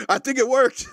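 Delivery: high-pitched, breaking into a laugh. Real, not acted. Host joking about the deer camera, laughing. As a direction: crack up while talking. Host cracks up at own joke.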